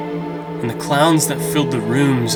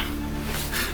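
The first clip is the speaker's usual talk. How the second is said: quick unvoiced nervous giggle; nervous breathy laugh; 10 dB quieter than usual. Real, not acted. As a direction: laugh off the fear softly. Nervous laugh after hoping not.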